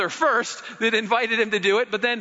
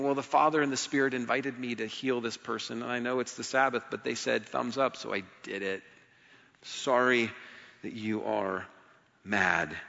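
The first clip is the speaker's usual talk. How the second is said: much softer, lower, almost hushed; soft inner thought; 9 semitones lower, 7 dB quieter than usual. Retold or imitated. Jesus thinking quietly about his critics, hushed. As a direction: murmur it to yourself, unbothered.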